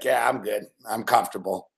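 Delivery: mock Belichick. Voicing Belichick in hoodie.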